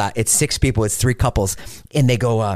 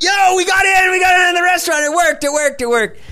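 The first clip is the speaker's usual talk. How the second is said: high-pitched, loud celebration; excited retold shout; 5 dB louder than usual. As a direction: yell it like you just pulled off a scam. Excited retelling of getting a table.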